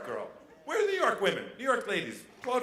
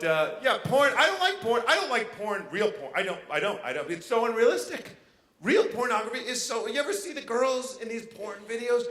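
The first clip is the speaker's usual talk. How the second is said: normal talking voice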